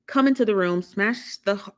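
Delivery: usual speaking voice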